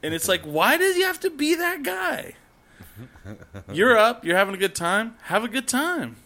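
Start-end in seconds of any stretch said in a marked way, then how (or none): 0.4-2.3 very high, squealed complaint; mock exasperation. Camper complaining about that guy, squealing in exasperation. Exasperated at the late-night yeller. 3.7-6.2 high-pitched mocking concession; mock indignation. Camper addressing the late-night yeller, high and mocking.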